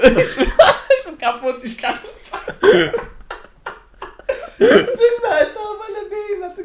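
high, loud words squeezed through real laughing; helpless laughter. Real, not acted. Joke teller loses it at the groom's line, helpless laughter. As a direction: crack up and barely get the words out.

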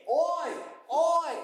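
a playful British "Oy!" shouted high. Pretend. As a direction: bark "Oy!" like a Londoner hailing a mate. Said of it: "Are you British?".

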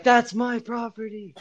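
high, slow mock scream. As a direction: imitate a friend's outraged screaming. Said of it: "screaming at me".

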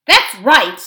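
loud, rowdy agreement; heckling dwarf crowd; chorus of dwarfs. A narrator's reading. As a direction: back him up at full voice.

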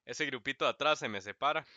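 profe regañón, más fuerte y agudo; regaña al grupito de atrás como profesor; profe regañando. Pretend. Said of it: "decirme básicamente a mí mismo". (un profe que regaña al grupito de atrás, más fuerte)

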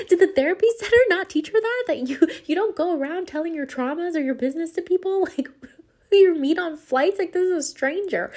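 high-pitched, amused disbelief; incredulous. Retold or imitated. Passenger baffled that a girl overshares with strangers, incredulous and high. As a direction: protest in a squeal of disbelief.

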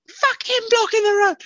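very high shrieking rant; imitating an aggressive bloke yelling; mock screaming. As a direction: scream it in her face like a thug. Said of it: "such screaming at you".

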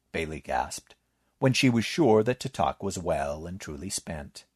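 ordinary delivery, nothing marked

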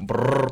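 rolled r brr ad-lib. Shown as a demonstration. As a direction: roll the r in brr like a rapper. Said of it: "I'll do it".

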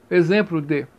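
ordinary delivery

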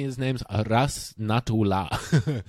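ordinary talk

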